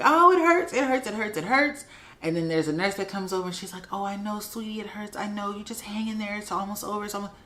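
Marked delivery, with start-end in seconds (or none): 0.0-1.8 high, pained mock wail; retold crying. Patient on the operating table, crying that it hurts. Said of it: "I start crying and I'm like". 3.9-7.3 soft, higher cooing nurse; super sweet. Retold or imitated. Nurse comforting a crying patient, super sweet. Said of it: "super sweet, right? Super sweet".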